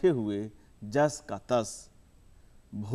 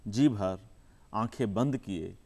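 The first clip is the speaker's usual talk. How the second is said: measured recital with long pauses between phrases; poem recitation; 5 dB louder than usual. Acted. Poet reciting his poem to the audience, measured and unhurried. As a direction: savour each image, let the lines breathe.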